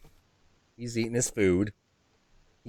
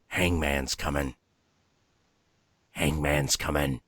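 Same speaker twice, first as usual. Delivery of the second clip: creepy raven voice; 10 semitones lower, 5 dB quieter than usual. Retold or imitated. Imitating Raymond the raven's creepy phrase, low and eerie. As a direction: make it sound like a bird that could haunt you. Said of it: "He says".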